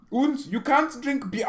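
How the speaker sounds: high, loud put-on German accent; comic German accent, loud and high; mock German accent. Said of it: "I'm gonna make accent jokes".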